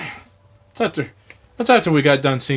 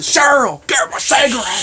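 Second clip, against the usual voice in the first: high strained yell at wife; whisper yelling; 11 semitones higher, 3 dB louder than usual. Retold or imitated. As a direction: bark it as a demanding husband. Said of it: "Skinny was doing the whisper yells".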